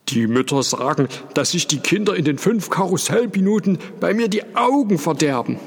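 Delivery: put-on high, fast, aggrieved old showman; Paul complaining. A narrator's reading. Carousel owner Paul complains about the mothers, high and fast. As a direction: rush it out in a high, wounded complaint. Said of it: "rief Paul".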